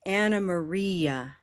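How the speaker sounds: Ana Maria slow, with a long REE; time on stressed vowel. Shown as a demonstration. Teacher asks everyone to try, lengthened stressed vowel. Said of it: "different amount of time on the vowels".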